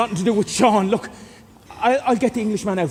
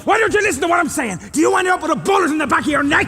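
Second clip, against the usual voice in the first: angry shouting; 7 semitones higher, 4 dB louder than usual. Acted. Angry threat to old man.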